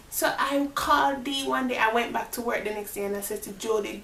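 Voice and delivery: normal talking voice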